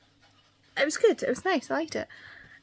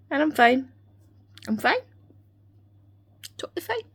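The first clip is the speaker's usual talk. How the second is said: sarcastic; 6 semitones higher, 3 dB louder than usual. Real, not acted. Sarcastic denial after finishing show.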